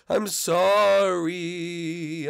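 slow, drawn-out ghost voice; ghostly apology. Acted. Blimpy the ghost apologising to Luigi, slow and drawn out. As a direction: stretch the apology out like a drifting spook.

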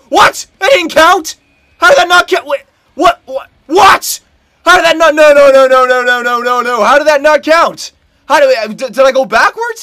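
high, loud shout of disbelief; real, loud incredulous yelling; outraged disbelief. Real, not acted. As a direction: protest the call at the top of your voice. Disputes his disallowed goal.

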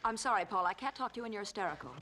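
low, controlled dismissal; cool composure. Acted. Wife calls husband hysterical, low and coolly composed. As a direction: turn the tables with pointed calm.

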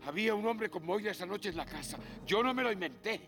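high, loud frantic cry; desperate shouting. Acted. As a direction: scream it, desperate to be believed. Desperate shouting at his brother.

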